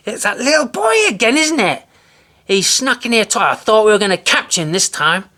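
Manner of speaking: high, loud exasperated yell; angry shouting wife. A narrator's reading. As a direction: shout it out in frustration.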